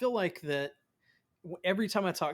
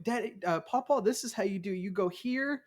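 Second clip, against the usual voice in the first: put-on higher-pitched voice of a little girl showing how; child explaining, pitched up; young daughter instructing; 5 semitones higher than usual. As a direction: imitate a daughter patiently teaching Papa. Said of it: "she'll go through all of it".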